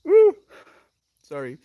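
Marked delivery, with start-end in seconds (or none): 0.0-0.4 sudden high, loud whoop; a real happy shout; joyful whoop. Real, not acted. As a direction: cry out with pure joy. Joyful outburst on stream.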